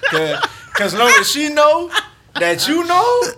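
excited rising yell. Real, not acted. Hyping up the side-eye moment.

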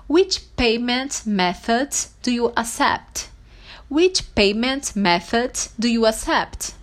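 slow, evenly spaced English question; model phrase. Shown as a demonstration. Teacher showing how to ask about payment, slow and deliberate. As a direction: enunciate each word for learners. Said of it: "você pode dizer assim".

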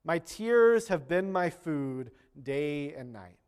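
slow, solemn psalm reading; scripture reading. Acted. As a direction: read it like a lament, slowly. Reads the psalm aloud.

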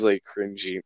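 ordinary talk

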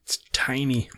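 soft whispered aside. Real, not acted. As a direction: breathe it under your breath. Quiet aside about phone mic.

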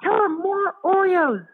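tiny creatures urgently calling for Oreos; excited critters. Retold or imitated. Revived critters begging the brain for Oreos, high and squeaky.

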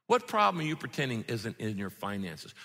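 normal talking voice

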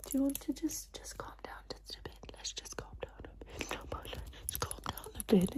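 soft, breath-only ASMR whisper; soothing whisper. Pretend. Doctor calming a nervous patient, soothing whisper. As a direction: whisper to settle someone down.

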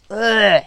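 loud, high "ugh" of revulsion; disgusted groan. Acted. Acts out disgust at his thoughts.

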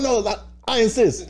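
high, mock-polite insisting; insisting politely. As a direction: playfully overdo the politeness. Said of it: "I was like".

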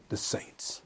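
a whispered The saints; whispered echo. Shown as a demonstration. Preacher echoing the verse to the church in a whisper. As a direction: breathe The saints out with no voice. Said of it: "What does he say?".

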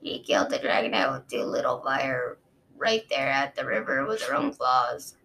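low, measured guard recounting; a dragon guard explaining to his queen, low and sober; Osprey, low and grave. Acted. Osprey voiced in story.

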